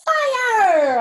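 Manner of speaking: high, loud game call; excited countdown. Acted. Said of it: "I said".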